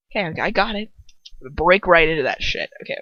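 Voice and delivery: ordinary delivery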